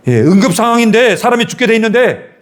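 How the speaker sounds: high, pressing pulpit appeal; urgent preaching. Real, not acted. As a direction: press the emergency on the congregation.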